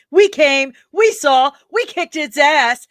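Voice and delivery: loud, high, punched-out victory cry; triumphant shout. Acted. Streamer performing a great film line, loud and triumphant. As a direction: shout it in three triumphant bursts.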